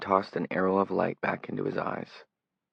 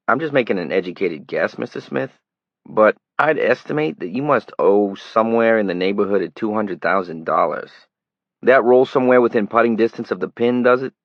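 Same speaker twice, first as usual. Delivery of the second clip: put-on a tabloid reporter pleads his long trip, loud Brooklyn accent; 3 semitones higher, 9 dB louder than usual. A narrator's reading. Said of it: "He had a flat city accent as well".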